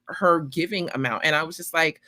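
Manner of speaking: ordinary delivery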